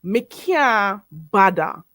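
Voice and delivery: slow Patois "Me can't bother", can't as "kyah", bother as "badda"; Jamaican Patois pronunciation. Shown as a demonstration. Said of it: "So you say".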